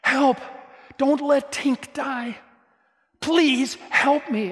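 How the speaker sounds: high pleading cry to save the fairy; Peter Pan pleading. Retold or imitated. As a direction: beg the audience for help as a frantic boy hero. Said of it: "Peter Pan goes to the audience and says".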